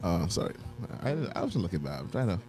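ordinary delivery

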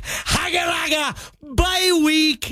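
a playful, half-shouted taunt at sweating rivals; gloating. Pretend. Fantasy player gloats over his bye week, mock taunt.

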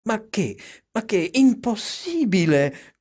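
high-pitched flustered refusal; mild cry of protest. A narrator's reading. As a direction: cry out in protest without much volume. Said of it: "gridò storcendo il collo".

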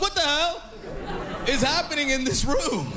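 high, incredulous mock exclamation; bewildered outburst. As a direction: react in hammed-up disbelief. Acted bewildered reaction to the quarrel.

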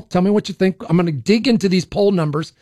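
ordinary talk, nothing marked

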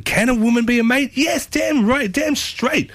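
very high, emphatic outburst; emphatic exclamation. Pretend. Host declaring women can be mates, high emphatic exclamation. Mock-indignant question and emphatic answer.

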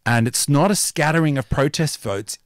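ordinary talk

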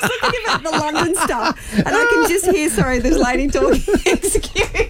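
high-pitched, laughing through the words; helpless laughter. Real, not acted. Co-host teased about her accent, apologising through helpless laughter. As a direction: try to apologise while cracking up.

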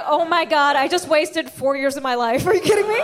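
mock outrage climbing to a laughing cry; outraged realization. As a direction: yell at four lost years. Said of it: "I was like".

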